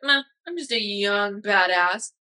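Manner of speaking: slow, offhand swagger; a lightly mocked young know-it-all; cocky shrug. Young graduate brushing off worry, slow and cocky. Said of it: "You're like".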